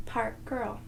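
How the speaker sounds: plain reading voice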